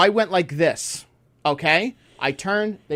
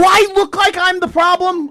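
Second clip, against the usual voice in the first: much higher, louder offended squeal; mock indignant; 7 dB louder than usual. Real, not acted. He jokes to cohost, high mock offense. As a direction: play the wronged innocent. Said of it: "that sounded real bad".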